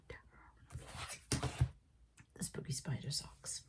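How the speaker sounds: very soft, low whisper; hushed whisper. Real, not acted. Vlogger calming down before showing her socks, hushed whisper. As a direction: whisper it under your breath. Settling herself, then naming the socks.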